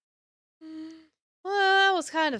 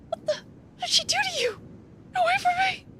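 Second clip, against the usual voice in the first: high, fearful shout; panicked cry. Acted. Marn cries out at Wiki, high and panicked. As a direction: push her away in sudden fear.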